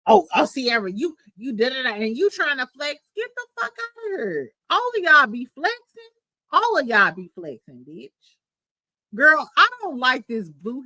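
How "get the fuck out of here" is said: dismissive. Real, not acted. Dismissing Spice's excuse.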